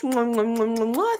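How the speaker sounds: smacking air kisses; blowing kisses. Real, not acted. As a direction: blow kisses at the camera.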